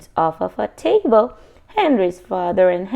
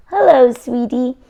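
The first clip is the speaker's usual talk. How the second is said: high, louder sweet grandma voice; grandma greeting; 4 semitones higher, 6 dB louder than usual. A narrator's reading. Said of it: "Henry's grandmother said to Mudge".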